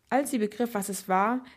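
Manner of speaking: plain reading voice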